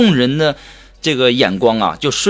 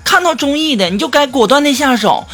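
high put-on voice, nagging; 7 semitones higher than usual. Friend nagging him about another failed date, high put-on voice. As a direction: imitate a friend lecturing in a raised pitch. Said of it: "然后这个时候苏木就问我说".

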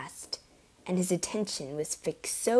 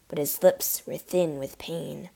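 plain reading voice

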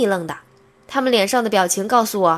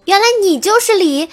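high, loud, thrilled cry; excited shout; 8 semitones higher, 6 dB louder than usual. Acted. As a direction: burst out in sudden delight. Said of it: "兴奋地大喊".

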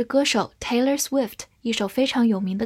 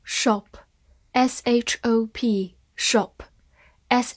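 slow spelling; much slower than usual. Shown as a demonstration. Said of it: "spelling demo, very slow".

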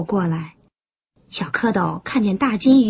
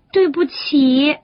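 slow, drawn-out little apology; embarrassed; 3 semitones higher, slower than usual. A narrator's reading. Tadpoles apologising to the crab, embarrassed. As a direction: apologise sheepishly, stretching the words. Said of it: "不好意思地说".

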